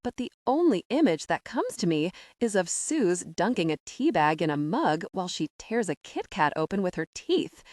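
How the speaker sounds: ordinary delivery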